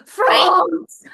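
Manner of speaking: high, loud cry of the country; shrill pageant shout. Pretend. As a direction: belt the country out like a beauty queen.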